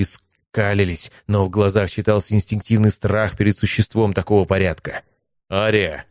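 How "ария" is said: usual speaking voice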